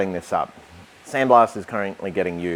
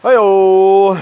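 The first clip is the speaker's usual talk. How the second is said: triumphant whoop; 11 semitones higher, 15 dB louder than usual. Real, not acted. Cut piece comes free.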